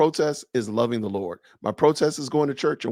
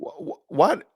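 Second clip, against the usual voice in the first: incredulous what; 6 semitones higher, slower than usual. Real, not acted. Baffled by the comment.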